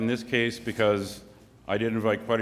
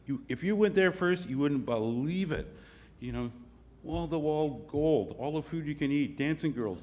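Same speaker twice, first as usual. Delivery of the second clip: enticing sales pitch; 5 semitones higher than usual. Acted. Promoter's sales pitch for hell.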